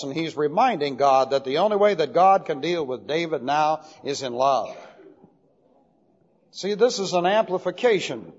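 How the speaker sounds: ordinary talk, nothing marked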